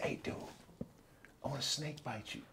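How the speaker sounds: very soft, confidential whisper; hushed whisper into someone's ear; retold whisper. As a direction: lean in and imitate a secretive whisper. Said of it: "he's whispering in M's ear".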